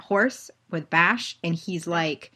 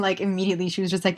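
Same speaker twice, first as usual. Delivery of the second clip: normal talking voice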